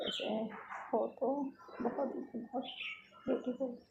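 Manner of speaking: soft, halting private thought; quiet musing with pauses; thinking to oneself. Acted. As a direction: murmur it as an inner aside. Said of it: "मन में सोचती है".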